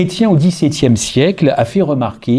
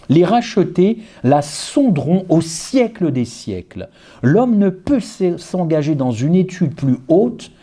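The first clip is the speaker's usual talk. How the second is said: higher, fervent preacher reading; 6 semitones higher than usual. Acted. Preacher reading the quote aloud to his flock, rising fervour. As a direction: lift the quotation with mounting fervour.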